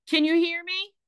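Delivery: high, urgent yelled question. A narrator's reading. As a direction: yell the question down to her. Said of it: "Skelly yelled".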